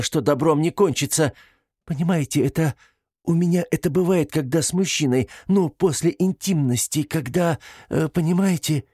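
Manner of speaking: high-pitched, halting, mortified confession; breaking, ashamed voice. A narrator's reading. Detained woman confesses intimate matters to investigator, voice faltering with shame. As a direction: stumble through it, voice close to breaking. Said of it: "женщина заговорила срывающимся голосом".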